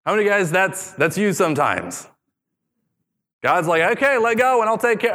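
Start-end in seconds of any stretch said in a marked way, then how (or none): 4.0-5.1 high, quick light take on God; mock God reassuring. God voiced in paraphrase.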